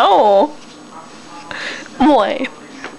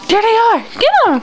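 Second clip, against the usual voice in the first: excited; 6 semitones higher, much faster than usual. Real, not acted. Mother cheering baby.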